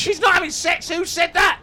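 high, squeaky little man; squeaky character voice. Acted.

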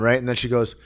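ordinary delivery

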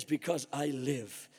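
low, slow, a hint of Jesus speaking gently; lightly voiced Jesus, low and unhurried; calm promise. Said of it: "he says".

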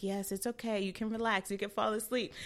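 soft, soothing medical reassurance; reassuring. Retold or imitated. Anesthesiologist telling patient to relax, softly reassuring. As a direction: imitate a calm doctor, softly.

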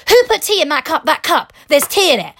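hammed-up fury over a cup; mock outraged coworker. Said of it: "And he was like".

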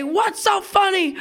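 high, yelled demand of a cross parent; mother's angry shout. Retold or imitated. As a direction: imitate an exasperated mom yelling. Angry mother quoted in story.

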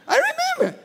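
usual speaking voice

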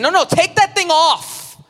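high, loud, insistent no; Jesus voice. Retold or imitated. Preacher voicing Jesus telling the weary to take it off, high and urgent. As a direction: urge them to drop the load. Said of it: "here's what Jesus is saying".

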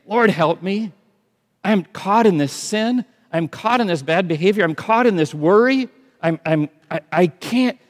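high, loud desperate plea; pleading cry. Pretend. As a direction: cry out like you're going under. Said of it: "And when you say".

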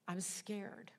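hushed, near-whispered admission; timid little half-whisper; scared confession. Retold or imitated. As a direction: say it small and soft, almost whispered. Retells frightened words to Holy Spirit.

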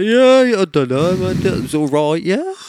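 lazy spotter impression. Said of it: "He's like".

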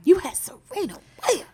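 high, pleading disbelief; mock exasperation. Scolding Drake over losing Serena, high and exasperated. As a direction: wail it at Drake as if appalled.

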